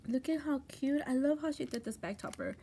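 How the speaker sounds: usual speaking voice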